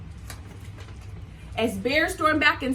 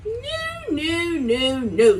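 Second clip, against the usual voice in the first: emphatic no with big swings; Lion objecting; 4 semitones higher, 5 dB louder, much slower than usual. Acted. Lion refusing the antler idea, emphatic. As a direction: insist like a proud lion. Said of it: "said Lion".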